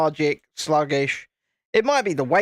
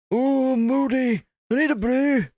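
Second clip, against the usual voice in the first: high, slow put-on Scottish voice; husband in a mock Scottish accent; 8 semitones higher than usual. Imitating moody husband asking for tea, in a mock Scottish accent. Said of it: "That's more Scottish".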